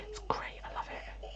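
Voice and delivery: soft unvoiced aside under the breath; hushed little aside; whisper. Real, not acted. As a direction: whisper it to yourself. Aside whispered during livestream.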